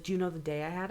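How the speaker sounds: hushed, downbeat imitation; subdued complaint. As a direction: quote the caller quietly, underplayed. Caller's line before shouted retort.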